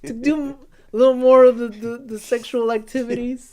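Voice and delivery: put-on high, loud, pleading kid voice; awkward boy king begging the priest; whiny boy king. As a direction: ham up a timid boy asking a priest for help. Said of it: "he's like".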